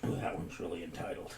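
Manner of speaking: whispered aside. Real, not acted.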